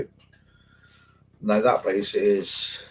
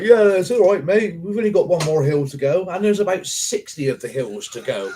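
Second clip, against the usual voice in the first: teasing brother; 9 semitones higher, 4 dB louder than usual. Retold or imitated. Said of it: "and he's like".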